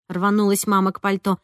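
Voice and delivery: ordinary delivery, nothing marked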